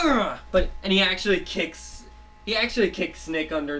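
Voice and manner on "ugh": pained grunt. Retold or imitated. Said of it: "He's like".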